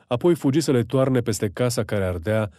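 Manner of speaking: ordinary delivery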